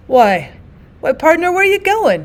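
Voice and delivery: bewildered, voice unsteady. Acted. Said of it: "he asked unsteadily".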